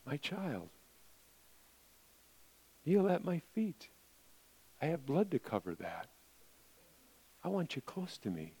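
soft, hushed and tender; gentle whisper of God's voice. Acted. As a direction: speak as a loving father to a child. Voicing God tenderly in sermon.

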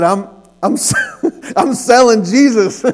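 high, loud, breaking into laughs; laughing retelling. Retold or imitated. Teen telling man at his door, laughing and stammering. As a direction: stammer the pitch through laughter.

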